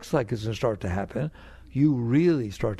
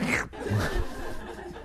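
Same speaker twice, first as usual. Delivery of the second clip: soft laugh; 6 semitones lower, 5 dB quieter than usual. Real, not acted. Laughs at soup slurping.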